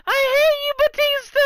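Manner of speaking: put-on high, loud kid's yell; little kid heckler. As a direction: shout it like a little kid in the crowd. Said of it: "All you hear is some little kid".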